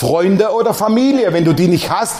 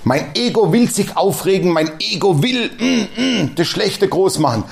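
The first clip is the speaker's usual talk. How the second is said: ordinary delivery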